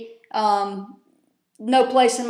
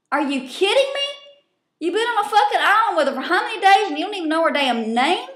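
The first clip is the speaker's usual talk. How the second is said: incredulous rant; 7 semitones higher than usual. Real, not acted. Ranting at Survivor player on screen.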